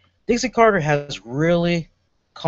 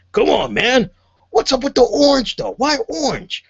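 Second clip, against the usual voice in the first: much higher, mock-incredulous Taz quote; 10 semitones higher, 4 dB louder than usual. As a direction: tease the orange suit in a squeaky Taz voice. Quoting Taz's jab at Spud.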